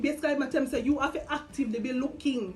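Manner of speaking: usual speaking voice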